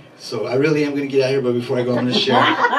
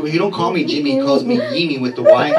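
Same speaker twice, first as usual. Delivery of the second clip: usual speaking voice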